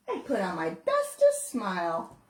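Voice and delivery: ordinary delivery, nothing marked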